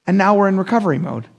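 ordinary talk, nothing marked

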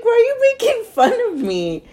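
squeaky high-pitched complaint; high whiny protest. Retold or imitated. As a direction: whine it out, way up high. Quoting herself, offended.

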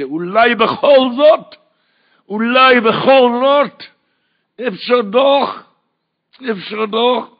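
slow, high, loud Yiddish-tinged plea; rabbi's drawn-out cry. Retold or imitated. Rabbi shouting to the boy, slow and high. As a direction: stretch each word like a wailing call. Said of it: "ביידיש".

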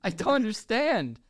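high, helpless protest; real comic bewilderment; baffled exasperation. Real, not acted. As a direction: give up in high-pitched confusion. Baffled by the script.

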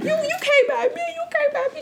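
high mock accusation. Woman at the bus stop accusing the other, high and laughing. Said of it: "she's like".

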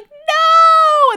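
long, high, loud mock wail of despair; anguished cry. Host acts out bookworm breaking his glasses, drawn-out anguished cry. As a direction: howl it out in hammed-up horror.

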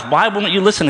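high, exasperated cry; ranting. Acted. Jesus ranting at the cities that rejected him, exasperated. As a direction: plead in frustration. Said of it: "then he starts ranting".

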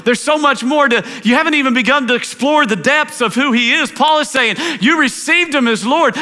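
climbing fervent sermon to a shouted peak; preaching at full force. Acted. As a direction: preach it hard, rising to the cry. Preacher builds to a climax.

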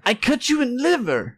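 high-pitched mock-Russian threat; Russian accent. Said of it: "adopt a Russian accent".

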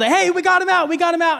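high, mild mock yell. Friends calling to boy running to mom, yelling. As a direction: call out the news from down the street. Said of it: "they yelled".

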